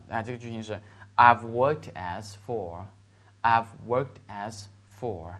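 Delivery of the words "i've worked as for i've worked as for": slow, spaced-out teaching delivery; slow model phrase. Shown as a demonstration. Teacher showing students a sentence pattern, slow and deliberate. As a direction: say it slowly, word by word for learners.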